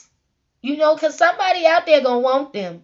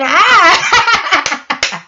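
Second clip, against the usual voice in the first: playful laughing greeting; 4 semitones higher, 7 dB louder than usual. Retold or imitated. Someone greeting her, rolling around.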